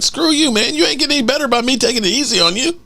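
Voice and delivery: put-on defiant young player telling off the lineman, high and fast; defiant retort. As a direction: snap back at the lineman, high and quick. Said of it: "I would be like".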